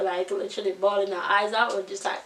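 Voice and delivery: ordinary talk, nothing marked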